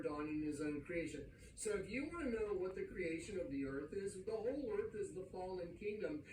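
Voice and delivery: usual speaking voice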